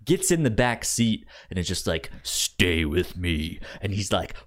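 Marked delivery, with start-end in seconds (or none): none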